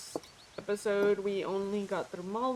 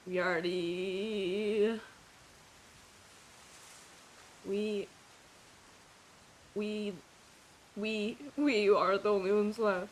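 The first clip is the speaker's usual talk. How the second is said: halting, choked-up voice, stuck on each word; struggling to get the words out through real emotion. Real, not acted. Player choked up at the game's ending, halting. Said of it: "I am so emotional".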